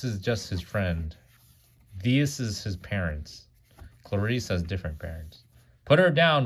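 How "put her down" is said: higher, louder heroic cry. A narrator's reading. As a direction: shout at the monster to drop her. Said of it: "Rudolph cried".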